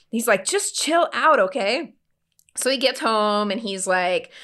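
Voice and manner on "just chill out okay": higher-pitched, mildly exasperated plea; retold placating. Husband to upset wife on phone, placating. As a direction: lightly act a husband smoothing it over.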